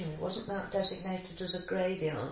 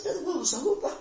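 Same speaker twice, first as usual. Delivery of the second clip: put-on higher, louder childlike objection; protesting child; 9 semitones higher, 6 dB louder than usual. Daughter replays answering father about the war, high mock protest. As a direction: imitate a puzzled child protesting, on the edge of a laugh.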